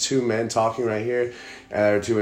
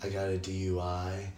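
quieter, understated demo of stiff talk; subdued podcast voice; 4 semitones lower, 13 dB quieter than usual. Shown as a demonstration. Host showing friend how he talked last episode, subdued. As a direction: drop it to a dull, low-key delivery. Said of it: "I'm seriously talking like this".

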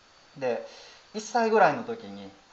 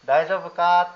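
louder, caring check-in; asking if she's okay; 4 semitones higher, 8 dB louder, slower than usual. Retold or imitated. Father patting his toddler's back, caring call. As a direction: call out to comfort a fallen child. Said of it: "って言ったら".

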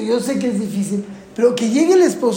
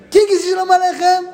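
put-on high, bright call to the kids; eager invitation; 8 semitones higher, 4 dB louder than usual. Parent calling the children to sing, excited. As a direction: sing it out like the best game. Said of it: "llega tu hijo de cuatro años".

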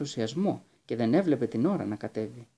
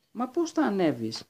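calling out; 8 semitones higher than usual. Acted. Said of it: "φώναξε η Άνικα".

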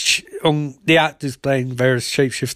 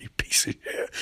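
whispered insult; 10 dB quieter than usual. Retold or imitated. Barry insults Eddie.

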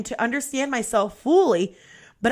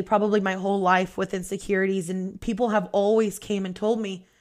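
normal talking voice

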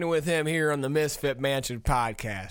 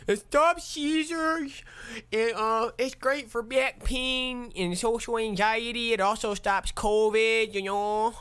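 much higher, slowed hammed-up salesman voice; mock pitchman; 8 semitones higher than usual. Mocking a cannabis cure to listeners, a high hammed-up pitchman. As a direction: sell the miracle weed like a cheesy ad.

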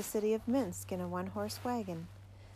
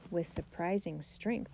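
ordinary delivery

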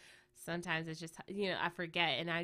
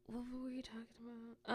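hushed, higher-pitched self-questioning; losing the thread; 4 semitones higher, 8 dB quieter than usual. Real, not acted. Podcaster recovering from coughs asks herself the topic, hushed. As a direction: murmur it to yourself, pitched up.

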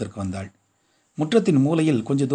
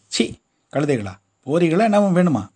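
loud, higher gruff rebuke; scolding threat; 5 semitones higher, 4 dB louder than usual. A narrator's reading. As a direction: shoo the ragged kids off. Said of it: "அதட்டினான்".

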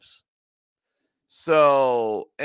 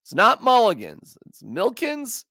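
ordinary talk

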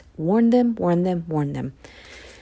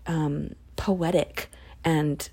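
normal talking voice

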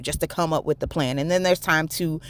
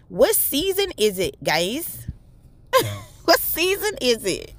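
laughing; 7 semitones higher than usual. Real, not acted. Laughing, high pitched question.

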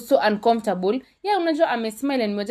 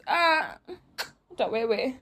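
normal talking voice

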